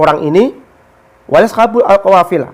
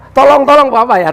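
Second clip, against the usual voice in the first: high, louder cries of help; retold cry for help; 7 semitones higher, 3 dB louder than usual. Merchant cries out to the robber for help. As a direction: call for help in a mock panic. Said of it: "teriak dia".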